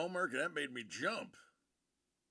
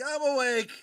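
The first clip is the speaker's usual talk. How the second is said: startled friend; 12 semitones higher, 13 dB louder than usual. Retold or imitated. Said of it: "Saporos is like".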